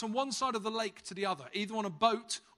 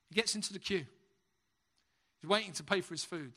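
ordinary delivery

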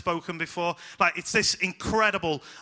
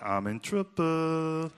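mock guilty dread; much slower than usual. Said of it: "you're like".

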